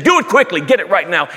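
father insisting the servants hurry; urgent order. Acted. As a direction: demand it right now.

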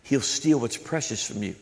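ordinary delivery

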